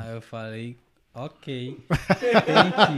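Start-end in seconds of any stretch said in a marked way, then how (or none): 1.1-3.0 laughing. Retold or imitated. Retold own English reply.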